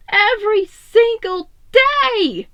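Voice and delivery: loud, high, slow cry of frustration; anguished yell. A narrator's reading. Donnie alone on the restroom floor, yelling in anguish. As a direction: yell it out in pain and despair. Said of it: "Donnie yelled".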